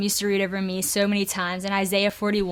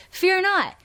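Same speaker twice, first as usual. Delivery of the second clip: sharp, much higher stern command; heard as a command; 9 semitones higher than usual. Retold or imitated. A mother's verse heard by an anxious child as a stern order. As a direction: bark it like an order you must obey. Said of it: "I used to see this verse, like, as a command".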